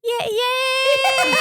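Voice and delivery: high, loud yay cheer; the family cheering the engagement; excited cheer. Retold or imitated. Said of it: "And then we're all".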